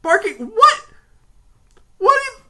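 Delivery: very high, loud barked yelp; a loud, squeaky bark of a voice; barking poodle voice. Acted. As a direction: yap it like a strained little poodle. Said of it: "barking like a constipated poodle".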